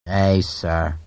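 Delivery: a low, gruff soldier's "aye, sir"; a curt, low acknowledgement; Harper's reply. Acted. Harper acknowledging his captain's order, low and gruff.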